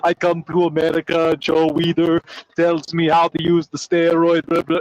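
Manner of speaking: a light mock Austrian accent, Arnold-style; Arnold's Austrian accent. Mocking Arnold's steroid excuses, light Austrian accent. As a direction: tease the bodybuilder in a hint of Austrian. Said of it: "He's like".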